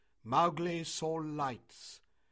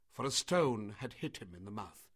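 plain reading voice

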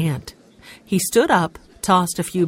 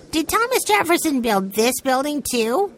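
put-on child voice; 9 semitones higher than usual. A narrator's reading. Benny voiced in read story.

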